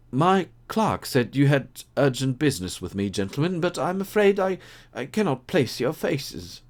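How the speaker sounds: high, nervous stammering gentleman; a rattled husband, voice climbing; flustered gentleman. Acted.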